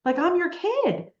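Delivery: high-pitched, incredulous mock protest; defensive teen retort. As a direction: re-enact a hurt kid challenging the parents. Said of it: "putting this armor on that was like".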